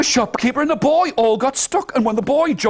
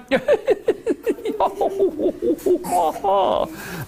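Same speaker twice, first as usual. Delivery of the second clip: high burst of laughter; the princess finally laughs; 10 semitones higher, 4 dB quieter than usual. Acted. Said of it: "And the princess went".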